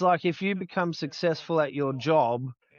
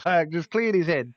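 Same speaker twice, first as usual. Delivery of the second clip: ordinary talk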